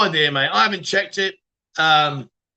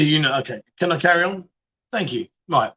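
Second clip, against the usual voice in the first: ordinary talk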